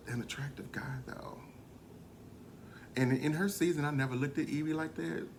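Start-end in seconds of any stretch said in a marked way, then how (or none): 0.1-1.4 whispered aside. Real, not acted. Whispering a secret aside to viewers. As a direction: drop to a whisper to confide it. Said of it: "whispered aside".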